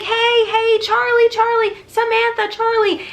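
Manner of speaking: high, loud urgent calls across a field; calling out her name. Retold or imitated. Running down the farm calling to Samantha, loud high shouts. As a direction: yell her name to get her attention.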